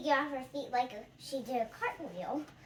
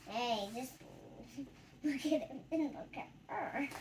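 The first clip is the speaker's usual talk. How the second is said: ordinary delivery, nothing marked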